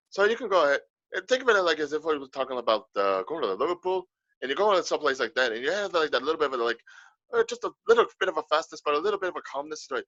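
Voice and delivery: quick but calm Irish brogue; Irish accent. Shown as a demonstration. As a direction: lilt it lightly in Irish, easy in the throat. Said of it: "An Irish accent has, like, a light throat feel".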